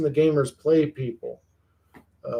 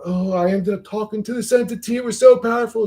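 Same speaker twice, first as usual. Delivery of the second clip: higher, breathless mock awe; mock awed tripper; 8 semitones higher than usual. Mocking DMT users who rave about entities, awed. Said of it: "what I find odd about".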